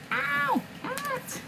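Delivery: sharp high yelp of pain; sudden yelp. Real, not acted. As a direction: cry out as claws dig in. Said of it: "me struggling with my cat".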